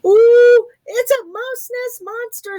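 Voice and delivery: high, loud squeal of fright; a tiny mouse squeaking in fright at a monster; frightened squeak. A narrator's reading. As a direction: squeak it out in terror. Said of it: "squeaked Little Mouse".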